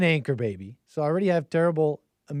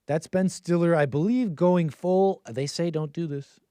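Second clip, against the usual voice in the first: ordinary talk, nothing marked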